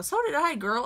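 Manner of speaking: high, teasing agreement; sarcastic reading. Real, not acted. Mock agreement with commenter.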